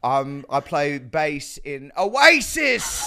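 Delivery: put-on botched Australian accent, fully English; hammed-up English rocker introduction, stretching the last words; Rebel Wilson. Comedian retrying co-star impression, bad English accent. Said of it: "you're fully doing English".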